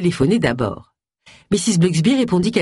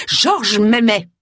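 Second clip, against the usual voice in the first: slow, emphatic, higher-pitched insistence; drawn-out vehement claim; 5 semitones higher, 3 dB louder, much slower than usual. A narrator's reading. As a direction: insist hotly, leaning on each word. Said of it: "affirma-t-elle avec véhémence".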